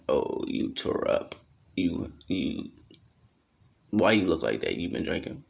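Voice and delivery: hushed, half-disbelieving aunt; worried aunt. Retold or imitated.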